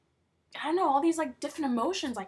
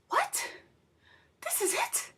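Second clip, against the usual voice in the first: much higher, stunned "what?"; shocked disbelief. Retold or imitated. Viewer reacting to the episode's abrupt end, stunned and much higher. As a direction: gasp it out like the ending robbed you. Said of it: "I was like".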